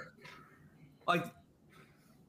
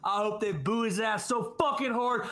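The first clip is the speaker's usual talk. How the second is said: usual speaking voice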